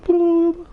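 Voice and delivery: put-on squeaky high silly noise to distract a baby; silly high bloop. To a crying baby, a squeaky high silly bloop. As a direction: pop out a tiny high bloop. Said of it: "I was like".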